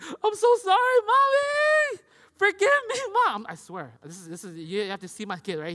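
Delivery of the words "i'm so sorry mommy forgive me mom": high, loud, over-the-top wailed apology; hammed-up imitation of a kid begging forgiveness; grovelling child. Son grovelling to Mommy after her telling-off, wildly melodramatic. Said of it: "he gets into this, like, mode".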